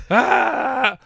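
high wail of frustration; exasperated scream. Real, not acted. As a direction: cry out in frustration.

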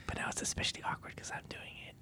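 whispering close into the ear; ASMR whisper, soft and intimate; right in your ear. Shown as a demonstration. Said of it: "This is me whispering in your ear".

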